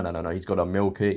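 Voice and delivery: usual speaking voice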